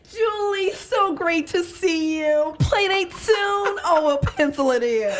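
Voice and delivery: high, drawn-out gushing playdate greeting; Sybil. Acted. Hammed-up friend waving hello to schedule a play date, gushing. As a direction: sing it out high and over-friendly. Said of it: "do for you her Sybil impersonation".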